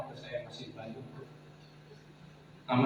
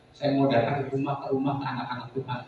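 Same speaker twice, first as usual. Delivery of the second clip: ordinary talk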